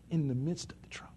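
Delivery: soft dramatic whisper. Acted. Preacher telling the congregation about thriving in trouble, whispered. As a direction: lean in and whisper it. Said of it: "whispered emphasis".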